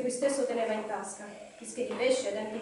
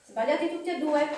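mild shout of relief, pitched up; relieved cry; 5 semitones higher, 4 dB louder than usual. A narrator's reading. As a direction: call it out, relieved, voice raised. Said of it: "gridò Bilbo, assai sollevato".

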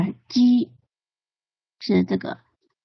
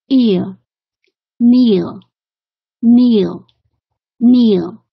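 each word said clearly, louder, with a falling finish; pronunciation drill; 4 dB louder, much slower than usual. Shown as a demonstration. Teacher drilling ear and kneel words for students, loud and clear. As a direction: model each word for the class to repeat. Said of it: "repeat-after-me drill".

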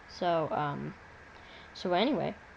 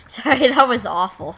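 laughing; 4 semitones higher, 14 dB louder than usual. Real, not acted.

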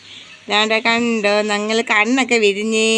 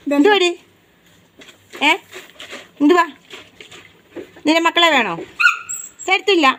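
put-on teasing baby talk; 8 semitones higher than usual. Teasing the mother dog.

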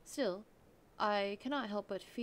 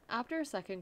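ordinary talk, nothing marked

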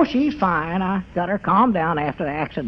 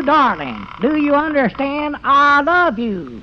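slow, higher crooning love line; hammed-up romantic; 7 semitones higher, slower than usual. Abner reads a romance to his cow, crooning. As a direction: croon it like a swooning lover to a cow.

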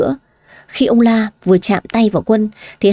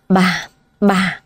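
choking with rage; 2 semitones lower than usual. A narrator's reading. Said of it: "tức điên người".

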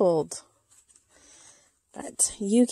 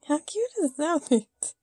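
higher, delighted, stress on "cute"; gushing; 7 semitones higher than usual. Real, not acted. Gushing at the doll's outfit aloud. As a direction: let "cute" ring out with delight.

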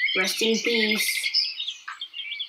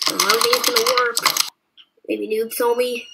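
normal talking voice

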